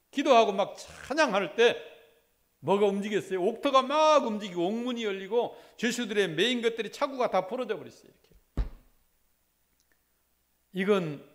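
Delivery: loud, driving pulpit preaching; fervent preaching. Acted. Pastor urging his church not to give in to the devil, fervent preaching. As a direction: press each phrase on the congregation.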